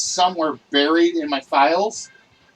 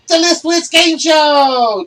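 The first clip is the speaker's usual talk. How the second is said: big, high, loud announcer build-up; game show announcer; 9 semitones higher, 8 dB louder, slower than usual. Acted. Host hyping his viewers about the show, loud and high. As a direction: sell the show title like a host.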